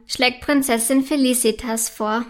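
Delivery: usual speaking voice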